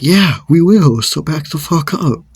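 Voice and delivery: re-enacted forceful comeback; defiant threat. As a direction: stand your ground in acted anger. Acted counter-threat.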